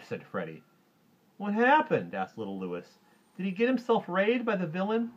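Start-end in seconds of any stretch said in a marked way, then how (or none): 1.4-2.1 put-on very high, loud child voice; high-pitched, anxious little-kid asking; little boy's question. Little Louis asks. 3.4-5.1 put-on very high, loud child voice; high-pitched, anxious little-kid asking; little boy's question. Little Louis asks about ray.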